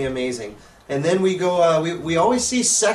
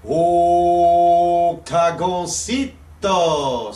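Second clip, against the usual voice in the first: long drawn-out ring announcer call; much slower than usual. Acted. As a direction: call the name like a luchador announcer. Announcing wrestler name.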